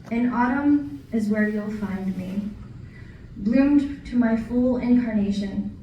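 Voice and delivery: projected, measured verse with line breaks; poetry reading. Acted. Poet reading an autumn poem to an audience, poetry reading. Reads next poem aloud.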